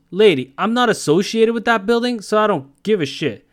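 high, loud mock snap at the lady; rude door guy. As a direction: brush her off like a gruff New York doorman. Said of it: "he goes".

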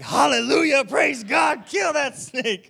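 high, loud hallelujah yell; mock triumphant shout. Preacher retelling running over a snake, loud jubilant shout. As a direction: shout it like a victory cry. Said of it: "but I was like".

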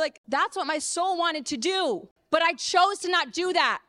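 ordinary talk

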